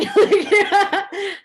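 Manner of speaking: high, loud burst of laugh-crying; a sudden overwhelmed sob-laugh. Real, not acted. Recalling bawling on the Sundance Zoom, laugh-crying burst. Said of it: "like, ugly crying".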